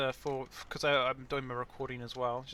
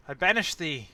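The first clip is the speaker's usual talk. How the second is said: loud archaic command, slowing; mock exorcist; 2 semitones higher, 16 dB louder, slower than usual. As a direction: banish it like a priest. Mock banishing the sneeze.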